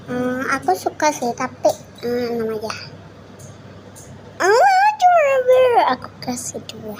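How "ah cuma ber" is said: squealing whine. Real, not acted. Rating items, squealing cry.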